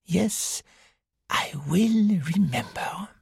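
slow drowsy whisper to himself. A narrator's reading. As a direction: murmur it as sleep comes, barely voiced. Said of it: "said Hercule Poirot to himself as he lay down to sleep".

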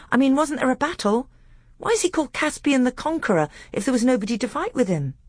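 put-on high, eager young prince's voice; eager young pupil. A narrator's reading. Caspian asking his tutor about the battle, eager and high. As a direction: press the point, pitched high and keen.

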